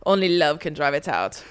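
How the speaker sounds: ordinary talk, nothing marked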